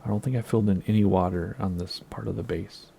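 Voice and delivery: normal talking voice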